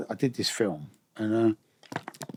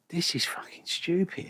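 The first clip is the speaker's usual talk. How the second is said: whispered aside; 6 semitones higher, 5 dB quieter, slower than usual. Retold or imitated. Private disbelief at actors' lives.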